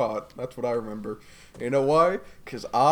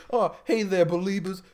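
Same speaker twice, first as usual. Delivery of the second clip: higher-pitched pop star impression; 7 semitones higher than usual. Pop star greeting his fans, high mock impression. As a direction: pipe up in a high, put-on Southern voice. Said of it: "This is, this is my [name]".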